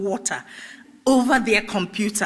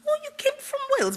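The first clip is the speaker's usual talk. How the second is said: high, quick posh English voice; posh English accent; much faster than usual. Retold or imitated. Interviewee mimics the woman in red, posh English accent. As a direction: put on a very posh English lilt. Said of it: "Very posh English accent".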